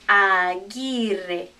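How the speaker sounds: Aguirre drawn out, "aah-GEE-reh"; hard G, silent u in Aguirre; Spanish pronunciation. Shown as a demonstration. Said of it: "Remember, this is Gui".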